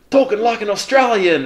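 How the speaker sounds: put-on a deliberately terrible Australian accent for a textbook reading; bad Australian accent. Recalling textbook recordings, a mock Australian accent. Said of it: "I did really bad accents".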